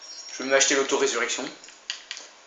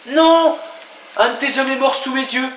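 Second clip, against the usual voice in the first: high, loud cry of dismay; shocked shout; 12 semitones higher, 8 dB louder, slower than usual. Real, not acted. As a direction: yell out in shock. Teammate dies in front of him.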